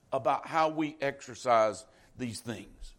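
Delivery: normal talking voice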